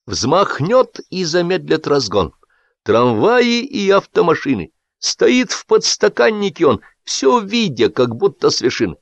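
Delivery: high, drawn-out verse declamation; reading verse aloud. A narrator's reading. Boss going on with the poem after a pause, declamatory. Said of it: "прокашлялся и продолжил".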